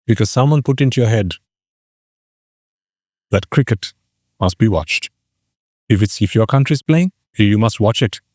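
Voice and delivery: ordinary talk, nothing marked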